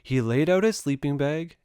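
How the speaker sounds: ordinary delivery, nothing marked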